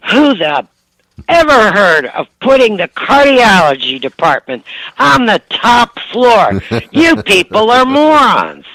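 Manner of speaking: high, loud indignant rant; imitating mom raging about the top floor; mother's rant. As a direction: rant at the hospital staff. Mother ranting from elevator.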